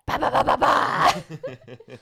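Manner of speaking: loud vocal drum beats; drum imitation. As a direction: belt out drum hits. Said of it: "I'm a screamo part".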